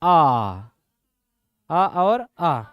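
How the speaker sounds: drawn-out throat vowels अ and आ; vowel demo. Shown as a demonstration. Teacher demonstrating vowels to students, drawn out. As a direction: sound आ long from the throat. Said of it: "कंठ में आपका आ जाएगा".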